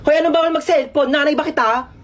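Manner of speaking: high-pitched sassy protest; hammed-up indignation; indignant comeback. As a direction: challenge them, offended and high. Snaps back at phone ban.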